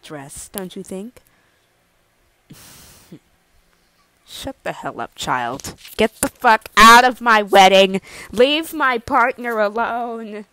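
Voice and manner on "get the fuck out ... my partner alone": loud angry outburst; a loud furious yell; furious shout. Acted. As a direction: throw them out of the wedding. Groom furious at intruder.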